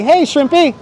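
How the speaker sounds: ordinary talk, nothing marked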